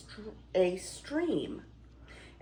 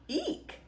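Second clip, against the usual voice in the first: shriek; 3 dB quieter than usual. Acted. Beast startles at thief.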